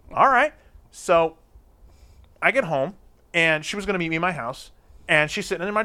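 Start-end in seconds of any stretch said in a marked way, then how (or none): none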